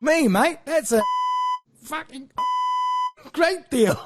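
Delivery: high, loud hammed-up Australian accent; an excited Aussie jumping at the deal; Australian bloke. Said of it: "the Australian went".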